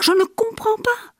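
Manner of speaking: voix qui monte très haut, perdue; bouleversé. Acted. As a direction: crier son incompréhension, aigu. Répète, voix montée très haut. (très aigu, désemparé)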